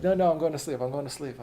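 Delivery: quickly retract the come-on, mock-alarmed; hasty backpedal. Backpedaling after rejected advance.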